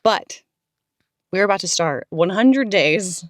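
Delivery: ordinary talk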